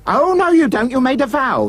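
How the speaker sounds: high-pitched indignant objection; scolding. A narrator's reading. Ugly sister holds prince to his vow, scolding.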